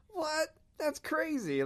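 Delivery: very high-pitched, amazed reaction; astonished. Retold or imitated. Couple hearing stranger is also from Austin, astonished. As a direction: react in retold disbelief.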